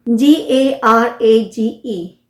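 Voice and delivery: normal talking voice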